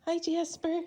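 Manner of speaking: high, sing-song hello to a cat; cooing greeting. Real, not acted. Owner greeting her new cat, high cooing. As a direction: coo a hello at the new kitty.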